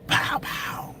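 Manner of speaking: breathy pow pow like paintball shots hitting the glass; a quick voiceless imitation of the shots; mock gunshot noise. Imitating the paintballs hitting his car, unvoiced pow pow.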